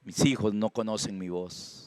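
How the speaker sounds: low, soft, understated lament; quoting the Lord. Retold or imitated. The Lord about a people who don't know him, soft lament. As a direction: voice God's complaint quietly and low. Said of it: "Dice el Señor".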